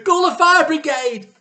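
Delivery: high, loud frantic call for help; wife crying out in panic; panicked cry. Acted. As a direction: scream for the fire brigade. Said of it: "cried Missus Cranky".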